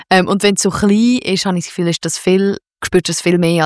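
ordinary delivery

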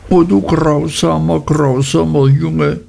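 put-on mild wail from a hurt young wife. A narrator's reading. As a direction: wail it like a wounded child. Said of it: "jammerte Dora".

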